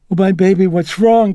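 mother waking to her daughter's screams, alarmed; alarmed exclamation. A narrator's reading. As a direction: rush to comfort in alarm. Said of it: "Chris exclaimed".

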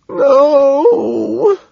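long, high, loud wail; sobbing wail. A narrator's reading. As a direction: howl it out, racked with sobs. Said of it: "Lewis wails, racked with sobs".

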